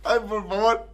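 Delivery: ordinary delivery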